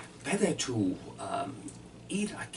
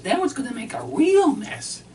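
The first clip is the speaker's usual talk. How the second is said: high, loud wife voice on the retake; mimicking his wife; 13 semitones higher, 8 dB louder than usual. Husband redoes his wife's complaint in her high loud voice. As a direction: redo the line pitched up as the wife. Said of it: "she says".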